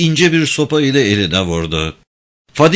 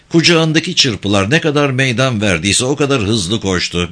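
plain reading voice

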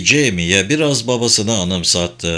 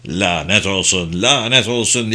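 plain reading voice

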